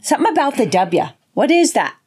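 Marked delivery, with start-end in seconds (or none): none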